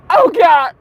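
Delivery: high, loud sneezy outburst; mock allergy attack. Pilot's allergies flare while flying, hammed-up distress. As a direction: cry out as if overcome by sneezing.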